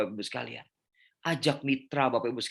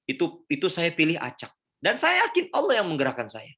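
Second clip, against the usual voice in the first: much higher and louder, rising with wonder; awed excitement; 6 semitones higher, 5 dB louder than usual. Real, not acted. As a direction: lift it up as a testimony. Marvelling at God's guidance. (penuh takjub dan semangat)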